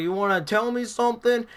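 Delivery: high-pitched mock yell; imitated scream. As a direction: mimic a man yelling a question. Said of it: "he screamed so loud".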